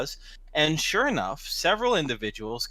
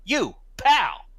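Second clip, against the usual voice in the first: high, loud confrontational call; cocky tough guy picking a fight; puffed-up challenge; 6 semitones higher, 5 dB louder, slower than usual. Acted. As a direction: call him out with chest puffed up. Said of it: "his chest is all puffed up".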